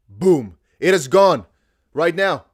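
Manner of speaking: loud launch call; excited shout. Real, not acted. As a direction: burst it out. Token launch moment.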